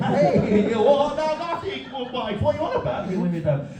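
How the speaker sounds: high, laughing, flustered denial; mock defensive denial. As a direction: play the accused man protesting. Acts out accused sex pest.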